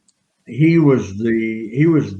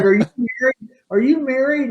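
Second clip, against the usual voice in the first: much higher, hammed-up eager question; mock eager woman; 12 semitones higher, faster than usual. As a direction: play the smitten fan asking twice. Woman asks the wrestler eagerly.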